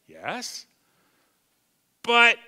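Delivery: normal talking voice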